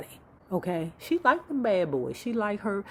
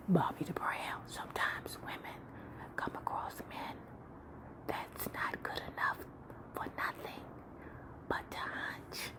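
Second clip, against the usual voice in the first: whisper; 11 dB quieter than usual. Acted. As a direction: whisper it close like a secret.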